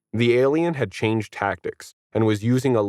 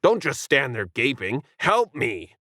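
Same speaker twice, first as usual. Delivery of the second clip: lightly voiced alien, higher; a hint of a desperate squid creature; 4 semitones higher than usual. A narrator's reading. Alien begging the onlookers, mildly alien.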